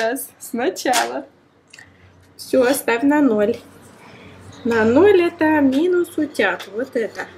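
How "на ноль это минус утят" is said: normal talking voice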